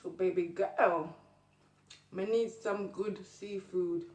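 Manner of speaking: slow, drawn out Jamaican patois; Jamaican accent. Acted. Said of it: "And I'm Jamaican".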